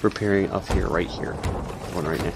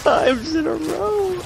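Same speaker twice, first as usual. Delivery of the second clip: high crying laugh, slow; shocked laughter; 12 semitones higher, 3 dB louder than usual. Real, not acted. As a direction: wheeze through helpless laughter. Hit repeatedly, cry-laughing.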